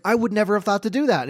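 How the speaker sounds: high, quick little-girl imitation; dad mimicking his impressed daughter; daughter's amazement. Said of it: "like, she's like".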